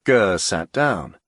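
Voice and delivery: usual speaking voice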